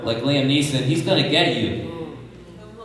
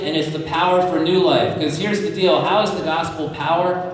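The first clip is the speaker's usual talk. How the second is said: rising preaching; 4 semitones higher than usual. Acted. Preacher builds on resurrection.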